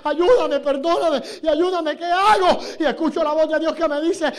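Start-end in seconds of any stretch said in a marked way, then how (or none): 0.0-2.6 pleading prayer. Retold or imitated. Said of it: "le dije".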